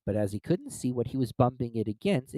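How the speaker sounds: ordinary delivery, nothing marked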